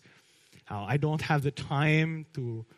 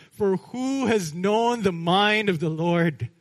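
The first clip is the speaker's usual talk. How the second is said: exuberant praise; 5 semitones higher, 6 dB louder than usual. Retold or imitated. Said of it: "an exuberant praise".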